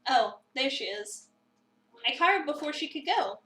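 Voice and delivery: usual speaking voice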